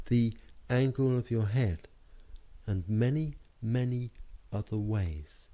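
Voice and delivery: normal talking voice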